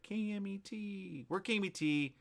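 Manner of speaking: high, drawn-out call letters; station promo call-out. Acted. Host promoting the local station, high drawn-out call-letter announcement. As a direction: stretch each letter out high and slow.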